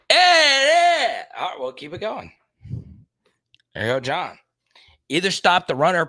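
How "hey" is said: shout. Real, not acted. Sudden shout at game.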